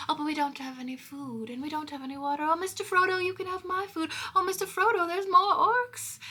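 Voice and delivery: mock-panicked, high-pitched pleading that escalates; Sam. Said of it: "and Sam being like".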